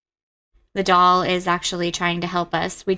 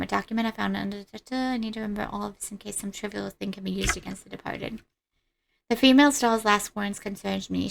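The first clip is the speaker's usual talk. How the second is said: quiet game narration; 5 dB quieter than usual. Acted. Reads game text quietly.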